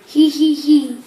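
loud childish mock giggle; giggling hee hee. Child reader voicing Cat's mock giggle. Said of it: "laughs Cat".

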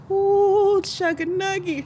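high, loud, tremulous nervous coo; mock fear as water slushes outside; hammed-up high whimper of nerves; nervous squeal. Car entering deep water.

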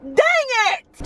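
high, exasperated yelp; frustrated outburst. Real, not acted. Scolding herself for being honest, a frustrated outburst. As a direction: blurt it out in annoyance. Frustrated she chose to pay.

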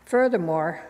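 usual speaking voice

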